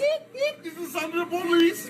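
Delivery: high whooping siren call; mock siren. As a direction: play a police siren. Mocking police siren.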